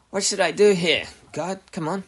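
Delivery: high, loud exasperated plea; impatient demand. Acted. Believer badgering God for guidance, impatient demand. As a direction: demand an answer, pushing pitch and volume up. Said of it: "There's a big difference, right?".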